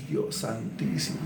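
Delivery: susurrado, lento y mucho más bajo, horrorizado; susurro escandalizado. Acted. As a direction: murmura espantado como quien no lo cree. (susurro sin voz, con asombro escandalizado)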